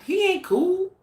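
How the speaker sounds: high-pitched mocking jab; mocking dismissal. Vlogger mocking Vlad to her viewers, high sneering tone. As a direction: toss it off like a scornful joke.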